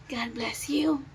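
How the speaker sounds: softly whispered blessing. Real, not acted.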